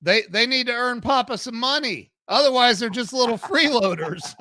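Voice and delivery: joking mentor. Retold or imitated. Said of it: "He goes".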